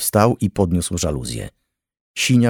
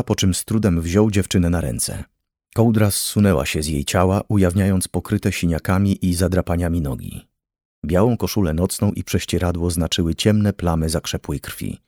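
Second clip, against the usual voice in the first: ordinary delivery, nothing marked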